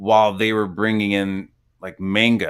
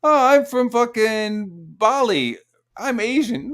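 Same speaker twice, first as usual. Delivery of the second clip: much higher, slow, put-on whiny voice; mocking imitation; 13 semitones higher, 5 dB louder, slower than usual. Mocking the Twitter guy's claim.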